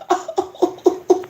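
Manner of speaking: high, gleeful laughter; high-pitched excited chuckling; delighted laugh. Real, not acted. Overjoyed at the verse.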